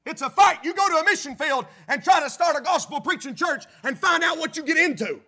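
loud, building sermon climax; preaching at full force. Acted. Preacher driving home the spiritual warfare point with loud fervor.

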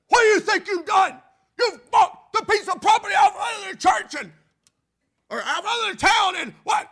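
furious shouting selectman, high and loud; angry shout. Retold or imitated. Selectman yelling at the pastor in fury. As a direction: bellow it like an enraged town official.

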